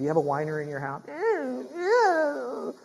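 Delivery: high, long drawn-out whine; mock whining wail; child's whine. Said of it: "one of those kids that whine a lot".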